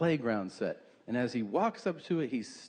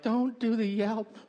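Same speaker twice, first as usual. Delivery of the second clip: lightly voiced pleading, pitched up; mock plea in a higher voice; squeamish audience member; 7 semitones higher than usual.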